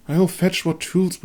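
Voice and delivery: a hint of the determined healer; Irmgard's voice. Irmgard answers.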